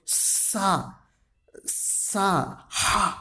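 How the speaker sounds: सा, सा, हा drawn out slowly with escaping air; aspirated fricatives. Shown as a demonstration. Teacher shows learners the air coming out, long airy सा, सा, हा. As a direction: push air out through each सा and हा. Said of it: "हवा निकल रहा है".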